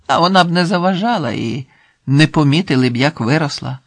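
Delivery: put-on lively old countrywoman's chatter; chatty elderly village woman. Old farm woman advising Yaroslav on children, chatty. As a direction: ramble on as a well-meaning granny.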